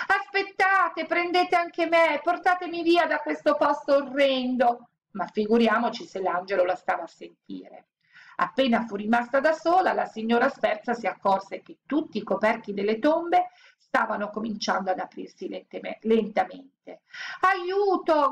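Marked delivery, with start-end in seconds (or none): 0.1-4.9 desperate shout. A narrator's reading. Woman left behind calling to the angel, desperate. 17.4-18.3 high frightened call; cry for help. A narrator's reading. Woman alone among opening tombs, screaming. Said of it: "gridò".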